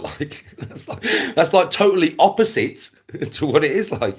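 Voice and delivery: words broken by laughter; laughing. Real, not acted. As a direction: laugh through it. Laughing at rival's error.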